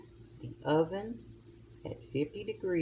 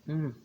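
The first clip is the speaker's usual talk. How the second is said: savoring delicious food; 4 dB louder than usual. Real, not acted. Tasting the dish.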